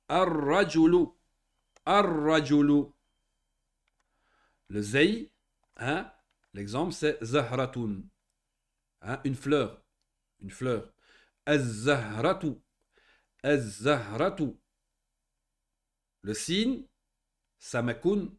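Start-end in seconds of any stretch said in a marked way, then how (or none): 0.1-2.8 "Ar-Rajulu" slow and loud, r rolled long; marked shadda. Shown as a demonstration. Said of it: "On va dire". 11.4-14.5 "Az-Zahratu" slowly with a long double z; marked shadda. Shown as a demonstration. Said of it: "Les shadda, il faut bien les marquer".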